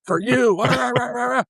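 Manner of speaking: high-pitched ranting imitation of a scolding boss; light into someone with garbled, high-pitched nagging; mock tirade. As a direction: imitate an angry rant dissolving into rah-rah. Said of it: "And he lit into me".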